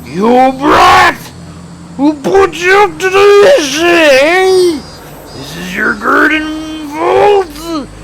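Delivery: high, loud furious shouting; angry thug yelling. Acted. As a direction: tear into the brat. Thugs yelling at him.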